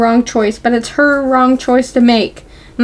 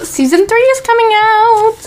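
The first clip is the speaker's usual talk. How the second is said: high-pitched excited announcement; real excitement, pitched way up; 10 semitones higher than usual. Real, not acted. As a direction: squeal the news with delight. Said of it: "I'm so excited".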